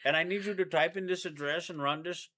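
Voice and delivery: mock Indian scam caller. Boyfriend playing a scam tech support caller in a mock Indian accent. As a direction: play a pushy fake support agent. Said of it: "Microsoft Tech Support".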